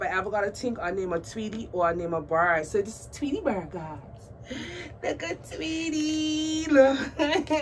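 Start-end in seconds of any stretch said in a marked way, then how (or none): 5.0-7.5 high, drawn-out coo breaking into laughter; cooing delight. Real, not acted. Showing viewers Tweety, cooing and laughing. Showing tiny doll adoringly.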